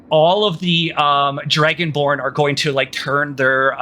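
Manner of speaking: ordinary delivery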